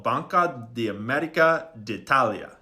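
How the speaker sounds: put-on Italian bank name in a showy Italian accent; amateur attempt at an Italian accent. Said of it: "Sorry for that accent".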